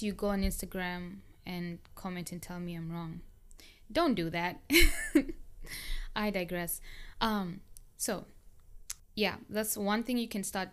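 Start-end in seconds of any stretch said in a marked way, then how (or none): 4.6-6.1 short bright-pitched chuckle, higher and louder; a quick laugh at your own aside; amused burst of laughter. Real, not acted.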